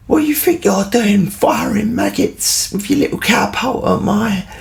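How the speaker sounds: furious stranger yelling accusations; angry man shouting. Retold or imitated. Big man yelling at boys by the canal, angry shout. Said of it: "he's saying".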